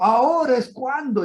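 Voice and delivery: eager excitement. Retold or imitated. Finally gets to share faith.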